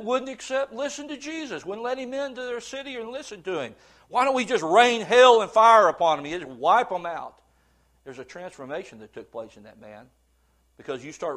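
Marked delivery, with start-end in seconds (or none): 4.1-7.4 loud, fierce call for fire from heaven; loud angry call. Retold or imitated. John and James wanting fire on the city, loud and angry. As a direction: bellow it like a zealous disciple wanting vengeance.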